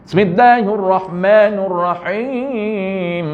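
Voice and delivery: Bismillahirrahmanirrahim pretty but without the ba; ba lost. Shown as a demonstration. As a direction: chant Bismillahirrahmanirrahim smoothly, dropping the b. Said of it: "Tapi ba hilang".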